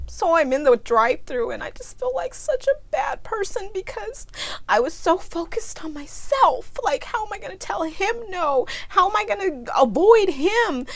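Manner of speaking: high, tearful voice breaking into sobs; real tears spilling through every word; crying. Real, not acted. Guilt over ignoring a hungry man, sobbing. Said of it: "I always cry when I tell this story".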